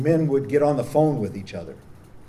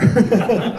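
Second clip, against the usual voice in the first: a real burst of laughter, louder; amused laugh; 9 semitones higher, 9 dB louder than usual. Real, not acted. Priest laughs at his own monastic joke, loud and amused.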